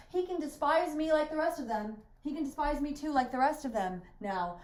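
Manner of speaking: rising furious defiance; proud outburst. Acted. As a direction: fling it at them in wounded pride.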